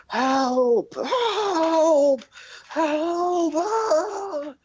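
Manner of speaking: distant victim screaming in panic; terrified screaming. Acted. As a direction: scream as if running for your life. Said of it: "running and screaming your guys' way".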